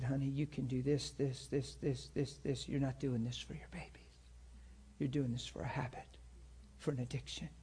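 low-volume, earnest plea; hushed confrontation. Retold or imitated. Pastor confronting a woman in an alley, hushed. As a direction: speak softly, almost under your breath.

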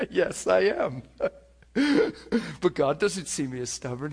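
real laughter breaking through the words; laughing it off, triumphant and amused; laughing delight. Real, not acted. Laughs at defying the devil.

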